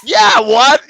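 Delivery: high, loud echoed disbelief; playful mock astonishment. Echoing the listener's disbelief.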